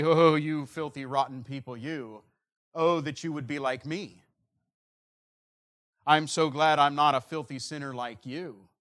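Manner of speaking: arrogant sneer. Acted. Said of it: "in an arrogant fashion and say".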